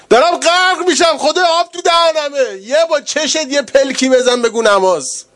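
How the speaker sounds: high, loud impassioned preaching; full-force preaching. Acted. Preacher crying out to the mourners about prayer, impassioned. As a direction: preach it with burning passion.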